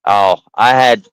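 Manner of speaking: ordinary delivery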